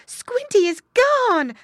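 high, loud, drawn-out wail of alarm; panicked mother pig crying out; frightened cry. A narrator's reading. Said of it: "cried Missus Pig".

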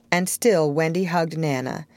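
ordinary delivery, nothing marked

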